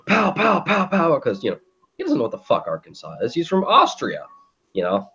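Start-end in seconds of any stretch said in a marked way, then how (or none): 0.1-1.2 gunshot sounds. Retold or imitated.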